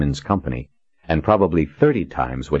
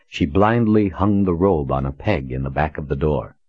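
ordinary delivery, nothing marked

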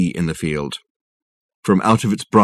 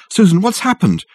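higher, louder anxious question; urgent worry; 11 semitones higher, 4 dB louder than usual. Acted. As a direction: press her for news.

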